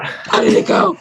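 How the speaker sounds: rough, voiceless croak of a question; post-anesthesia croak. Retold or imitated. Patient waking after her procedure, wrecked voice. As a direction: croak it out as if just waking from surgery. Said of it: "like, ten octaves lower".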